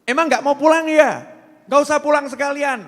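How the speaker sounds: loud, high furious scolding; wife yelling at husband not to come home at all; angry wife. Retold or imitated. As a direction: snap at the husband who came home late.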